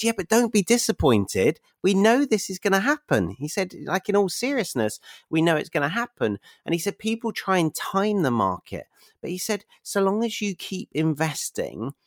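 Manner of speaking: lightly voiced mentor. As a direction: reassure like an older guide.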